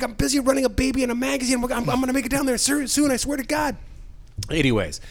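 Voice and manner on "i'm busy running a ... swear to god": high, pleading retold excuse; mock harried excuse. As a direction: plead busy, pitched way up. Acted excuse to naggers.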